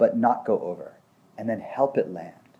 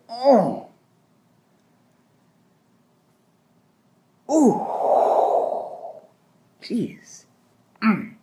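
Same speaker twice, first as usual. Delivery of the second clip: high, breathy oh and ooh as the feeling hits; imitated release sighs; 10 semitones higher, 3 dB louder, much slower than usual. Client lets the anger land in session, sighing high and open. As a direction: let each sound rise up as the anger moves through.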